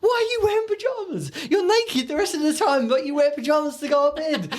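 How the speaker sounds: very high mocking Ricky voice; Ricky teasing Elmo about pajamas, high pitched; Ricky Gervais impression. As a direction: squeak it up, teasing. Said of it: "He goes".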